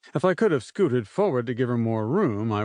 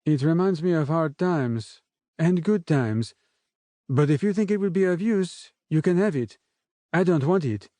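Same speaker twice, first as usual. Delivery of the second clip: a light, soft French accent, voiced higher; 6 semitones higher than usual. A narrator's reading. Gabrielle chatting to the narrator on the raft, a light soft French accent. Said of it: "her soft French accent".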